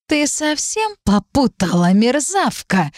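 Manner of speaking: high, slow venomous hiss; hissing angrily. A narrator's reading. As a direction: spit the insult out slowly. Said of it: "прошипела девушка".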